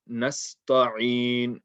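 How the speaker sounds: "Nasta'in" with a heavy, overemphasized ta, drawn out. Shown as a demonstration. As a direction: overdo the ta in "Nasta'in", thick and dark. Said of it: "made it heavy".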